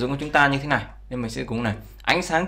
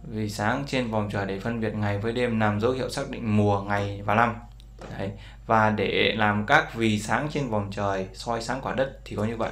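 normal talking voice

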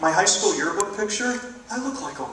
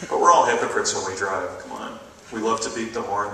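ordinary delivery

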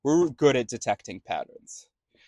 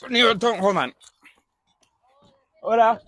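slipping into an Italian accent; high, loud Italian accent aside; 11 semitones higher, 6 dB louder than usual. Real, not acted. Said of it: "I started to pick up and do an Italian accent there".

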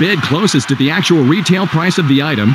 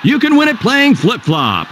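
excited shout; 5 semitones higher than usual. Acted. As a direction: hype up the game name. Announcing the pricing game.

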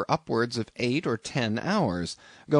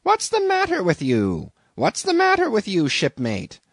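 higher, louder indignant innkeeper; indignant landlady; 9 semitones higher, 5 dB louder than usual. A narrator's reading. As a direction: scold the young man for prying.

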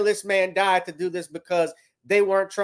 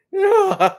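high loud burst of laughter; 12 semitones higher, 6 dB louder than usual. Real, not acted. Laughing at an Uzi meme: loud high real laugh. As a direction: crack up at the picture.

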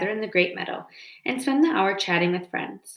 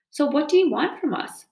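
growling fox; 7 semitones higher than usual. A narrator's reading. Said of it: "growled Fink".

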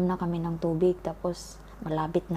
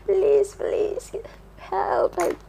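much higher, wailing, crying plea; auntie crying for help; 12 semitones higher, slower than usual. Retold or imitated. Auntie begging people to save her drowned son, high crying wail. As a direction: imitate a frantic aunt sobbing for help. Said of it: "umiyak na yung auntie ko".

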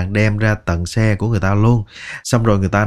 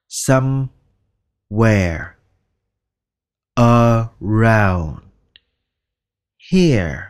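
"Somewhere", "around", "here" stretched very slowly; drawn out; much slower than usual. Shown as a demonstration. Teacher drawing out every sound for learners. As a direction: stretch each word out. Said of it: "slow word drill".

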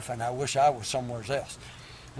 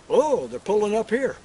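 louder, hearty hello to riders; calling out greeting; 2 semitones higher, 6 dB louder than usual. Acted. Cowboy hailing riders pulling up, loud greeting. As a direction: call out to them across the yard.

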